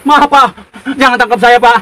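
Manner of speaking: loud, desperate pleading; frightened begging. Acted. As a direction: beg not to be taken. Eceng begs in a nightmare.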